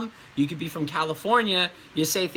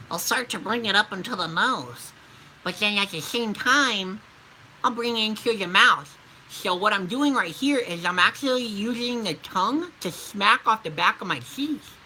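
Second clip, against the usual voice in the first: nasal nerd voice; geeky, nasal character with tongue-heavy speech; nerdy character; 3 semitones higher than usual. Shown as a demonstration. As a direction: bring it up into the nose, tongue against the teeth. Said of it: "a nerdy character that likes pens".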